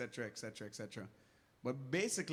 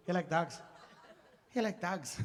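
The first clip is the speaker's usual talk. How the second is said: Snatch-style mock accent; 3 semitones higher than usual. Parodying Snatch as Caribbean, mock Pikey accent. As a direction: fire it out in a garbled Pikey accent. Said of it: "Caribbean snatch".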